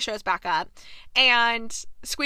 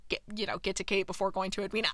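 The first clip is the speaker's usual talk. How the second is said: usual speaking voice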